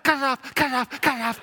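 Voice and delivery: high, loud, panicked repeats; frantic begging. Retold or imitated. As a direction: beg urgently through the pain. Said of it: "I said".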